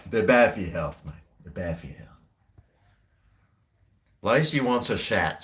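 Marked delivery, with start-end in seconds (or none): 1.4-2.1 soft, lowered mutter; hushed aside. Acted. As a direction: say it again under your breath. Repeated, hushed.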